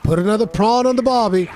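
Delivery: loud, high mock Australian accent, stressing prawn; corrected Aussie line. Streamer showing the corrected Australian phrase, loud mock Australian accent. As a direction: proudly say it the right way in a put-on Australian twang. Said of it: "I always said".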